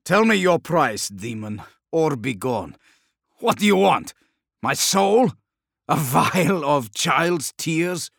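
loud, defiant challenge; angry shout. Acted. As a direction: demand it loudly, furious. Scrivok confronts the demon.

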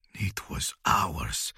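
slow, breathy whisper of loss; whispered murmur. Acted. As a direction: whisper it to his reflection. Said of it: "he murmured".

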